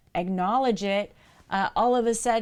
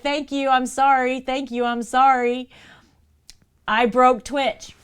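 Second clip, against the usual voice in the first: loud, higher, frantic apologizing; panicked apology; 5 semitones higher, 7 dB louder than usual. Retold or imitated. Streamer apologizes to chat, mock panic, loud and frantic. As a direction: gush apologies in a hammed-up panic.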